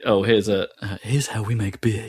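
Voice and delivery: put-on tour guide in a Dutch accent. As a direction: explain beer-making in a Dutch accent. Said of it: "imagine all this in a Dutch accent".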